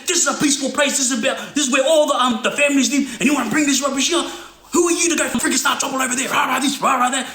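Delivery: put-on furious older guy yelling at the younger men; retold yelling. Older guy berating the young crew, hammed-up angry shout. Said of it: "He's going off".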